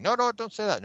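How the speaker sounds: high-pitched mock objection; imitated protest. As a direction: voice the worried group hushing someone. Imitating everybody's objection to report.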